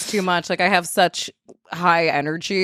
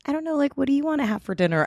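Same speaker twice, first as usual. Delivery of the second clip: high, lightly coy question; affected feminine voice; 7 semitones higher, 4 dB quieter than usual. Shown as a demonstration. Asking boyfriend about dinner, affected feminized voice. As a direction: pitch it up, sweet and undecided. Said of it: "like, this kinda like".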